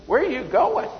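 high-pitched, incredulous imitation of a caller; mock bewildered question. Pastor phoning the driver who passed him, mock bewilderment. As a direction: ask it high, as a baffled pastor would.

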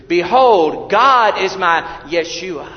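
loud, high, ringing reading; proclaimed scripture. Acted. Preacher reading Isaiah twelve to the congregation, ringing proclamation. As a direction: declare the verse to the whole hall.

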